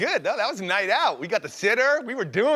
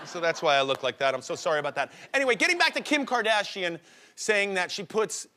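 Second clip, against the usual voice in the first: ordinary delivery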